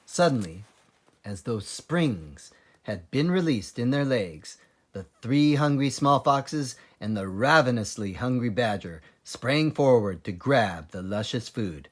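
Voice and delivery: ordinary delivery, nothing marked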